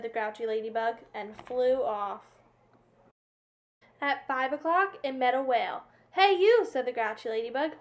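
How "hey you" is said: loud, high call of challenge. A narrator's reading. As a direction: yell it out to pick a fight. Grouchy ladybug picks a fight.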